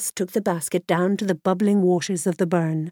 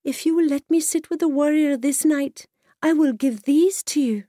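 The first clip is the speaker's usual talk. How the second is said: put-on higher, earnest young girl pleading; girl's voice; 7 semitones higher than usual. A narrator's reading. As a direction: offer it like a hopeful lass.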